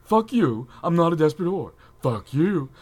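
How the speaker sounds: annoying chick voice. Acted. Co-host reading a fan's denial, indignant chick voice. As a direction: protest loudly as an annoying chick. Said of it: "In your best annoying chick voice".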